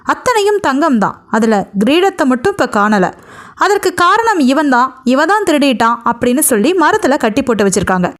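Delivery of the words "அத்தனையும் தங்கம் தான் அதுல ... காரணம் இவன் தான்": ordinary delivery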